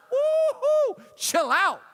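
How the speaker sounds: high whooping hoot, then a loud protest; hammed-up high, loud recoil; startled reaction. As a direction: play being taken aback by a yell. Acted reaction to the big voice.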